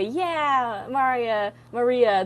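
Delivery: acted friends cheering her on; supportive cheering. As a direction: cheer the name encouragingly. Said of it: "with your friends, they will say".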